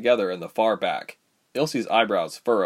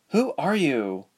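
lightly voiced girl, higher pitch; girl's wary question; 6 semitones higher, slower than usual. A narrator's reading. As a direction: ask who this is, mildly guarded. Plait girl questions the newcomer.

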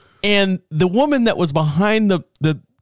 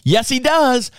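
higher, firm "Yes, he does"; insistent reply; 7 semitones higher than usual. Retold or imitated. Man at the window insisting to the driver, higher firm reply. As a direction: insist right back. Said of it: "And the guy goes".

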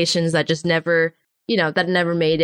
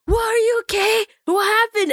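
concerned crowd; 14 semitones higher than usual. Retold or imitated. Voicing worried onlookers.

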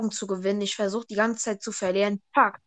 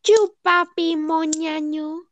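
meme catchphrase; 4 semitones higher, 4 dB louder, slower than usual. Retold or imitated.

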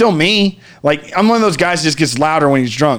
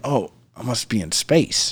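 softer, slower, lower murmur; hushed wonder; 6 semitones lower, 8 dB quieter than usual. Retold or imitated. Floating in the void, hushed and dreamy.

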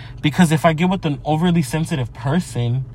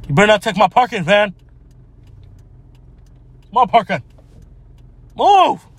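raised, high-pitched territorial demand; demanding, calling out; 6 semitones higher, 4 dB louder than usual. Real, not acted. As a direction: scold the other driver, ending on a loud high "Move". Demanding driver leave parking spot.

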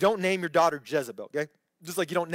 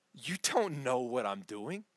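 gentle divine rebuke; 6 semitones lower, 7 dB quieter, slower than usual. Acted. God's reply paraphrased by preacher.

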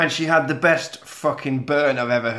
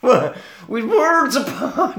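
high, drawn-out botched Scottish accent; attempted Scottish, sounds Irish; 8 semitones higher, slower than usual. Shown as a demonstration. Attempting her line from the notes, botched Irish-sounding accent. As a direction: try Scottish and slip towards Irish. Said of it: "That's Irish, anyway".

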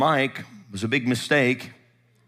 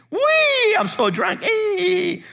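loud, piercingly high mock squeals; fake drunken whooping; 4 dB louder, much slower than usual. Pastor mimics the wife sitting looking at him, squealed fake drunkenness. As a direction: imitate her giddy drunk act at full squeal. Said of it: "it was all fake".

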